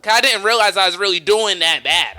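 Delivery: ordinary talk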